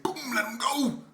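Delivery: high "boom" collapsing into laughter; punchy retold command. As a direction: imitate the blow, then crack up. Re-enacting punch, breaking into laughter.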